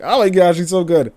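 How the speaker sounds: high, loud, gushing admiration; gushing fan. Retold or imitated. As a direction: gush over him like an excited fan. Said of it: "don't mind me if I'm just like".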